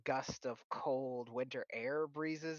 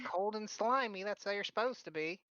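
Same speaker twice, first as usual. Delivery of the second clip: granny voice; 7 semitones higher than usual. Acted. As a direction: check him over like a fussy grandma. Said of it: "she's like".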